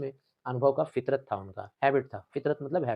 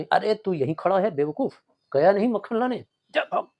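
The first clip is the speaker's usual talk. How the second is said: scolding the servant; 6 semitones higher, 6 dB louder than usual. Acted. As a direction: bark at the dim servant to get going. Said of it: "डांटते हैं".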